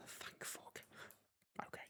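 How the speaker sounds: very soft, voiceless whisper; barely audible relieved whisper. Real, not acted. Relief after checking the recording.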